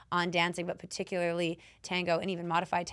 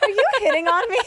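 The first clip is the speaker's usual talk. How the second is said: laughing; 9 dB louder, faster than usual. Retold or imitated. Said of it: "I was like".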